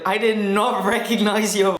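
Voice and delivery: high laughing quote; laughing while quoting. Retold or imitated. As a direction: quote her through a laugh. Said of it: "And she's like".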